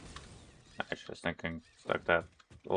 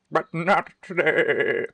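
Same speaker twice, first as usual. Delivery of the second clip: slow, loud, higher quip; playful retort; 8 semitones higher, 11 dB louder, slower than usual. Acted. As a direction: drag it out as a joke. Jokey punchline after mumbling.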